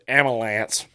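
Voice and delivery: AMBULANCE as am-uh-lance; mocked mispronunciation. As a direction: imitate "ambulance" without its b: am-uh-lance. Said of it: "Robbie said".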